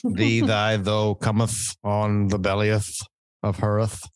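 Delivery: mock Old English, slow and theatrical; hammed-up olde speech, drawn out; renaissance fair olde speech. Joking about Old English episode.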